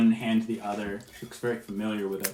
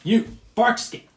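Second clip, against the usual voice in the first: higher, louder hail from a wary harpy; harpy calls out; 12 semitones higher, 7 dB louder, slower than usual. Acted. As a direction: bark the name across the camp at her.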